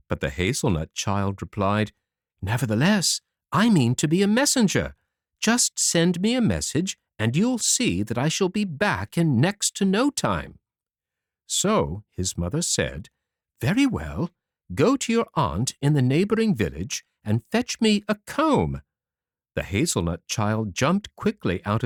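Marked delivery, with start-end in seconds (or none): 2.4-10.6 higher, lightly voiced little boy; a small son standing firm, voice raised; determined little hazelnut boy, higher; tiny son's voice. Tiny son insists on his plan. 13.6-18.8 mother voiced higher, lightly; a lightly voiced mother pressing her son; higher-pitched mother questioning her son; mother's voice. Mother sends son on errand.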